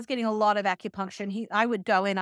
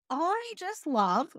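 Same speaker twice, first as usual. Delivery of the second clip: slow, drawn-out sarcastic love; 5 semitones higher, much slower than usual. Real, not acted. Mocking the melatonin shelves, slow and sarcastic. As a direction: lay the sarcasm on slowly. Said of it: "I'm being sarcastic".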